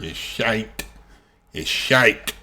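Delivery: lightly mimicked Scottish accent. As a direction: savour it in a mock Scottish accent. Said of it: "favorite word in the Scottish accent".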